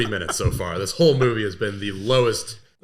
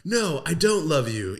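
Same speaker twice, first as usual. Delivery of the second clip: high, slow mock-heroine voice; melodramatic rejection; 6 semitones higher than usual. Girl rejecting Pinocchio for dramatic tension, mock melodrama. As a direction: push him away in a hammy high voice.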